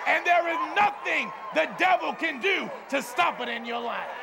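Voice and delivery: high, shouted revival cadence; full-force preaching. Real, not acted. Preacher promising great grace and victory, fervent shouted preaching. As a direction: drive each phrase out to the crowd.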